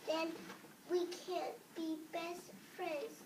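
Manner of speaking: ordinary delivery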